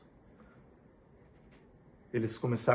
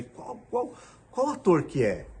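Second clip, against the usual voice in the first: eager surprised question; 10 semitones higher, 5 dB louder, slower than usual. Retold or imitated. Teen asking which actor.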